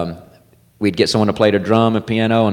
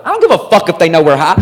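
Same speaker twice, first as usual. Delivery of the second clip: fast, higher, brash retort; defiant friend; 4 semitones higher, faster than usual. Retold or imitated. Friend dismissing buddy's paranoia, brash. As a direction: blurt it out, not caring who hears. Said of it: "my boy Josh goes".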